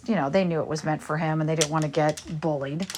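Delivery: ordinary talk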